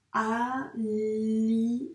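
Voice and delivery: very slow, drawn-out "Alicia" checked sound by sound; a teacher rereading the written word with the class. Shown as a demonstration. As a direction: read it back slowly, sound by sound. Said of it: "on va essayer de se relire".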